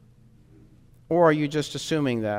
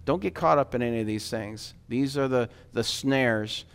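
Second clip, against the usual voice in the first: ordinary talk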